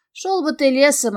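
ordinary delivery, nothing marked

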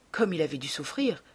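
usual speaking voice

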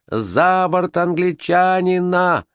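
high, very slow drawn-out mob shout. Acted. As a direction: demand he go overboard, high and drawn out. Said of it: "кричали другие".

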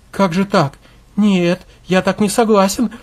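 high, pleading protest; grieving husband pushing back, voice up. Acted. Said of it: "запротестовал её муж".

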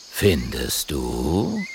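low, very slow flattered purr; flattered big-cat drawl; flattered panther. A narrator's reading. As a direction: stretch it out, basking in the compliment. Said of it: "sagt der Panther geschmeichelt".

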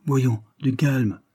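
slow, soothing reassurance; slow it right down to calm her. A narrator's reading. Carlyle soothes the weeping daughter, slow and calm. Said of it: "fit Carlyle".

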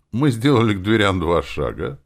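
plain reading voice

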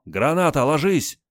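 high, loud shouted warning; grenade warning yell. Acted. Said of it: "и крикнул".

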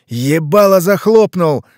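slow, loud furious bark; enraged snarl. Acted. Leshy silencing Ivan with a slow loud snarl. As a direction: slam the words down in rage. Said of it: "взъярился Леший".

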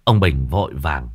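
ordinary delivery

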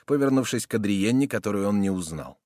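plain reading voice

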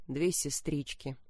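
whisper. A narrator's reading. Narration sinking into a whisper.

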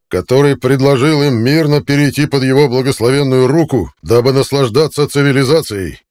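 loud, high, all in one breath; bombastic speech. A narrator's reading. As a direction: rant it like a propaganda officer. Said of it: "на одном дыхании".

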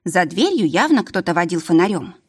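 ordinary delivery, nothing marked

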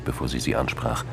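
usual speaking voice

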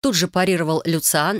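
plain reading voice

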